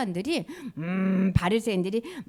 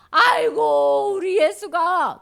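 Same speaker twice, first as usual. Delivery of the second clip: 아주 느리게 끄는 한탄; lamenting mother; 7 semitones higher, 5 dB louder, much slower than usual. Acted. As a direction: draw out the sigh of grief slowly. 가족이 예수 걱정에 한탄. (아주 느리게 탄식하는 어머니)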